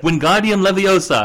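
spell incantation. Pretend. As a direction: chant the spell grandly. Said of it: "She's like".

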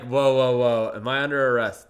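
loud protesting whoas; retold alarm. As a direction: halt them with a loud, worried question. Retold alarm at police order.

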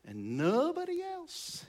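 very high, drawn-out falsetto; hammed-up slow squeak; mock self-centred. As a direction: stretch it out way up high. Mocking a self-centred believer's view.

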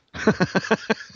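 bursting into laughter; can't hold back the laugh; cracking up mid-line. Real, not acted. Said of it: "I'm laughing at my own joke".

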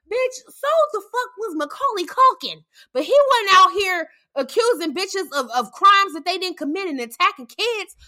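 mocking her excuse, shouting; angry shout. Real, not acted. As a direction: fire it back at her, outraged. Angry retort, yelling.

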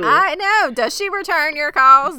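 high, loud sassy friend; mock sassy voice. Friend teasing someone who is being ghosted, high and loud. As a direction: tease him like a nosy friend.

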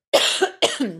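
real throaty cough; a quick dry cough. Real, not acted. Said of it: "Excuse me, I need to cough".

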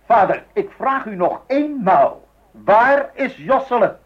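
stern final demand. Acted. Husband gives the father a last, slow, loud ultimatum. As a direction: weigh every word as an ultimatum.

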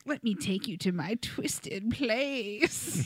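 slow breathy creep whisper; creepy whisper. Retold or imitated. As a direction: whisper it like a creepy rock singer. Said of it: "[name] is like".

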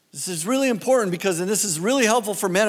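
ordinary talk, nothing marked

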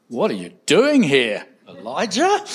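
"Elijah?" rising high at the end; changed inflection. Shown as a demonstration. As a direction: lift "Elijah?" into a sharp high question. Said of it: "you can change the inflection".

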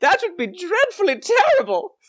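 very high, hammed-up pleading; intimidated old lady. Gatekeeper lady begging about the light, mock-frightened high voice. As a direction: plead in a theatrical falsetto. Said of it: "this lady, is gonna say".

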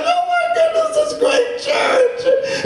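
very high gushing squeal; hammed-up thrill, stretched out at the end; acted excitement. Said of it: "I was excited. I was like".

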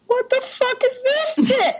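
high, slow, incredulous outrage; mock outrage. Watching Paw Patrol, shocked by the dress texts, high and slow. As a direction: squawk it in disbelief. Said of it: "And I'm like".

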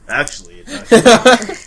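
high, loud mock know-it-all; pompous NPC voice. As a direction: ham up a smug scholar. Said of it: "I can't wait till he says".

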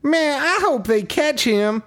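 high, slow put-on boyish voice; squeaky hopeful kid voice, drawn out; Noah impression. Mimicking Noah from the movie.

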